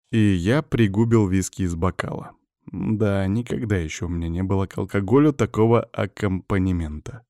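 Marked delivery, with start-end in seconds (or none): none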